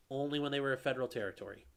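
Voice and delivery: ordinary delivery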